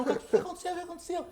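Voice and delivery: high-pitched mock panic; alarmed questioning. Mimics alarmed people questioning him as child.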